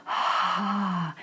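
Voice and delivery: soft airy sighed ah; breathy sigh. Shown as a demonstration. Teacher demonstrating a breathy onset with a soft sigh. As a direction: let the breath flow out on an h. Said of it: "Say an H and let your breath flow".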